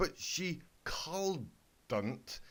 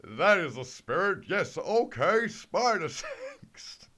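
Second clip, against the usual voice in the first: loud, higher, hammed-up cheer stretched on the end; excited cheer; 6 semitones higher, 9 dB louder, slower than usual. Big Mac cheers Twilight on, loud hammed-up whoop. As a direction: whoop it out like a goofy victory yell.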